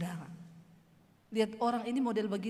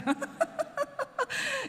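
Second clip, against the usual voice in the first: high laughing burst; burst of laughter. Real, not acted. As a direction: laugh out high at the cheeky remark. Preacher laughs at insult. (tertawa lepas)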